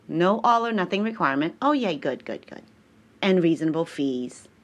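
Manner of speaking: normal talking voice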